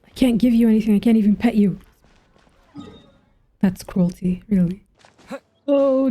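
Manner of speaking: ordinary delivery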